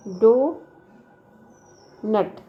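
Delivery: Donut very slow, long gap between डो and नट; syllables far apart. Shown as a demonstration. Teacher showing syllables in the examples, donut broken in two. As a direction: stretch डो, pause, then नट. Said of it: "the underlined syllables".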